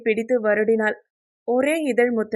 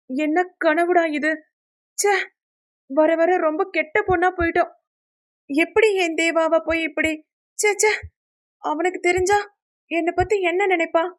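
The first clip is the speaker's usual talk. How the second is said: high, flustered self-scolding; embarrassed self-disgust; 6 semitones higher than usual. A narrator's reading. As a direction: tut at yourself in a raised, rattled voice. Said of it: "தன்னையே அசிங்கமாய் உணர்ந்தவள்". (தன்னையே நொந்துகொள்ளும் பதற்றமான குரல்)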